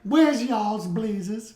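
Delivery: high, slow New Orleans accent imitation; Mary Ann. Repeating a friend's line for the co-host, strong New Orleans accent. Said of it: "they got this strong New Orleans accent".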